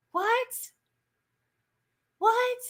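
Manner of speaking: sharp high disbelieving what, twice; incredulous what. Real, not acted. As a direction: react as if you can't believe it. Disbelief at guards' question.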